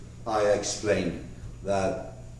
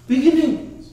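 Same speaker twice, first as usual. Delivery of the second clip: higher, louder, announcing the answer; emphatic answer; 12 semitones higher, 6 dB louder than usual. Real, not acted. Teacher answers his own quiz to students, higher and louder. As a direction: land the key word hard.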